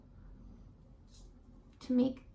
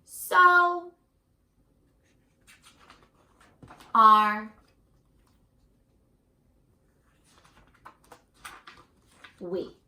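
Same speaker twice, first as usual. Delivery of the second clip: slow, weighty reading with long pauses, louder towards the close; storybook reading; 5 dB louder, slower than usual. A narrator's reading. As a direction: build to the last words, then let them fall away. Reading picture book aloud.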